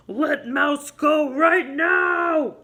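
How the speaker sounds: slow, loud growled command; growling bear. Acted. Bear growling at Bobcat to let Mouse go, slow and loud.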